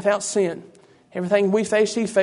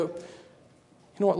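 hushed; 8 dB quieter, much slower than usual. Acted. Preacher drops voice before a point.

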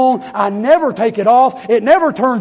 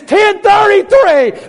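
hollered code; 9 semitones higher, 4 dB louder, much slower than usual. Shown as a demonstration. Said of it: "all I've gotta holler".

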